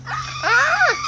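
short high scream. Real, not acted. Boy startled during the chase, short high scream. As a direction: shriek in sudden alarm. Sudden danger in the game.

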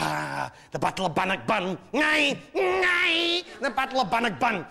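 put-on high, loud, slow Scottish bellow of old glory; Scottish rant, rising to a shout; mock Scottish battle cry. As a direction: roar it like a proud Jock reciting Bannockburn. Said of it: "You like to say".

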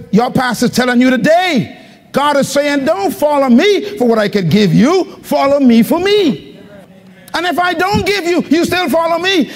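voicing God's call to the congregation, fired up; full-force preaching. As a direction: drive the message home like a preacher.

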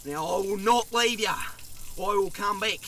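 father soothing his son; low reassuring promise. Acted. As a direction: promise him quietly you'll come back. Dad calms his son.

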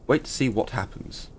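ordinary delivery

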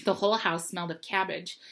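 usual speaking voice

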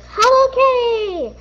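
high, loud angry call. Acted. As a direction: shout her name in anger. Angry mom calling child.

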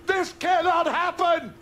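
high, outraged protest at the news; outraged cry. Acted. Father raging about his son's romance, outraged. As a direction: burst out in disbelief and anger.

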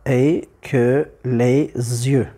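slow, evenly separated syllables; deliberate verse scansion, beat by beat. Shown as a demonstration. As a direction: scan it slowly for counting. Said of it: "il faut avoir le S prononcé en liaison".

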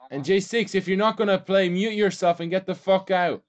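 high, loud, real irritated shout; angry shouted order. Real, not acted. Gamer telling a non-player to get off voice, angry shouted order. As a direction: yell it as an exasperated order.

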